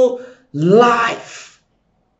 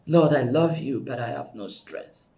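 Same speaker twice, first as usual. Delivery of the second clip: low, hushed pleading, fading almost to nothing; a broken whisper of a plea; much softer and lower, near tears; retold tearful prayer; 10 semitones lower, 10 dB quieter than usual. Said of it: "be crying and saying".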